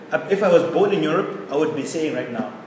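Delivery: ordinary talk, nothing marked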